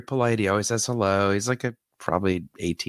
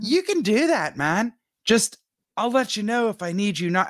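nervous imagined speech; 11 semitones higher than usual. Retold or imitated. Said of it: "imagined talk to neighbor".

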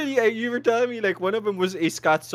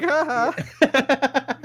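high gloating ha ha; mocking laugh; 10 semitones higher, 4 dB louder than usual. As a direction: laugh in their face, higher.

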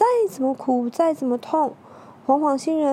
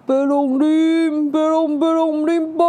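alien voice; 5 semitones higher, 5 dB louder than usual. Acted. Alien language nobody understands.

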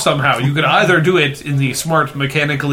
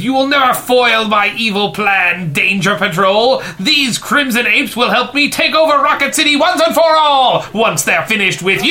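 villain voice; 8 semitones higher than usual. Acted. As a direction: gloat like a mustache-twirling villain. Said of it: "twirls his, uh, mustache and says".

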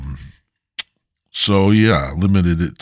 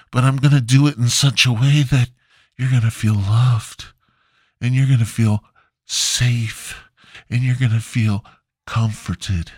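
friendly death stare; 5 semitones higher than usual. Retold or imitated. Comic voicing the cashier's stare as a sweet, sinister higher voice. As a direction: promise horror as if it's comfort. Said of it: "friendly death stare".

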